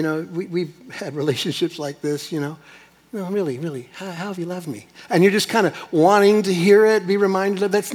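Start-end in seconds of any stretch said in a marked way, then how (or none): 3.1-4.9 insecure pleading. Pretend. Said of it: "like a confused, insecure question".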